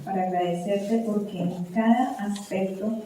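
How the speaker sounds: soft, reverent closing prayer. Acted. Pastor praying for parents at close, soft and reverent. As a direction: pray quietly and humbly to the Lord.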